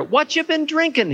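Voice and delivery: put-on high, bright, eager old man; curious old man. A narrator's reading. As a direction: ask it like you're genuinely fascinated. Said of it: "his eyes sparkling with interest".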